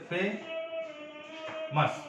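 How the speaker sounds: Famous with a very long, high first syllable, fe-mas; famous pulled apart slowly into two beats; two drawn-out syllables. Shown as a demonstration. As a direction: stretch the first half of famous high, then drop on mas. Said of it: "এখানে দুটো".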